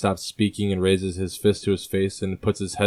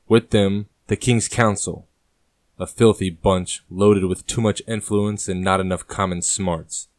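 ordinary talk, nothing marked

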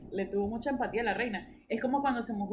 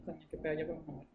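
quick, quiet grumble at herself; fast low self-scolding; muttered aside; 6 semitones lower, 8 dB quieter, much faster than usual. Real, not acted.

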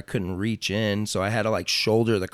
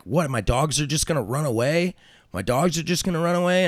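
higher, incredulous retold question; mock disbelief; 6 semitones higher than usual. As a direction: play the stunned owner losing his dogs. Retold shocked dog owner after crash.

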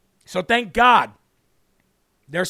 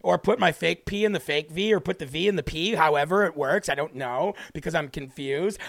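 affected mimicry, sinking lower and softer; mocking affected voice; 4 semitones lower, 3 dB quieter than usual. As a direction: parody affected speech, tailing down in mock confusion. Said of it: "it's clearly a choice to talk like that".

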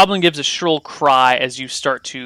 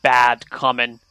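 ordinary delivery

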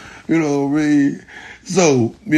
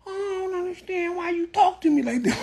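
mocking whiner; 14 semitones higher, 7 dB quieter than usual. Mocking people who complain.

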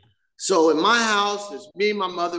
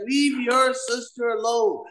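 mom scolding; 6 semitones higher than usual. Retold or imitated. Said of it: "My mom be like".

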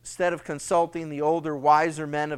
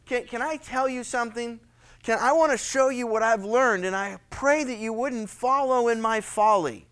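earnest plea; 5 semitones higher, 4 dB louder than usual. Pretend. Solomon pleading with his son.

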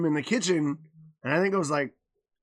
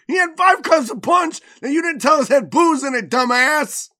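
high, loud accusation; mock indignant yelling; 9 semitones higher, 8 dB louder than usual. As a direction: imitate a mouthy kid shouting blame at mom. Child yells blame at mom.

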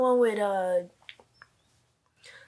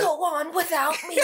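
hammy dying hero farewell; overacted death scene; 6 semitones higher than usual. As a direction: ham up a noble dying goodbye. Joking dying hero line.

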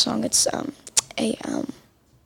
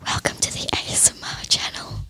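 soft, close-mic whisper, much softer; ASMR whisper; 8 dB quieter than usual. Shown as a demonstration. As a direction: breathe it into the mic like an ASMR channel. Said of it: "do really quiet voices and they go up to the mic".

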